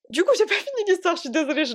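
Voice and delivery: giggling through the words, pitch up; amused at losing the thread; laughing. Real, not acted. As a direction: laugh at your own rambling. Laughs at own digression.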